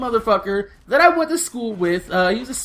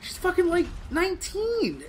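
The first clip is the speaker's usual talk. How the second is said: much higher, softer, trailing; high sheepish answer; 8 semitones higher, 5 dB quieter than usual. Retold or imitated. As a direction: admit it sheepishly. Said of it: "I was like".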